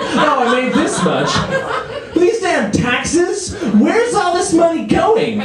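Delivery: high, hammed-up indignation; pay-stub outrage. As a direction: squawk in disbelief at a shrunken paycheque.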